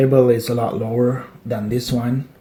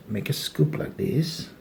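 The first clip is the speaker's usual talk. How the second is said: ordinary delivery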